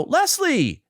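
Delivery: high, loud greeting; calling out. Retold or imitated. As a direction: call his name across the elevator. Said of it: "And I go".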